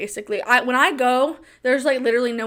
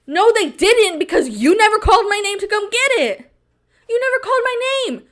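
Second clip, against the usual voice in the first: indignant retort; 9 semitones higher, 4 dB louder, faster than usual. Real, not acted.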